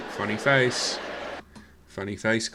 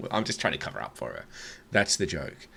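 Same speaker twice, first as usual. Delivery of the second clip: ordinary talk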